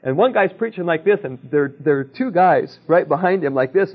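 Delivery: ordinary talk, nothing marked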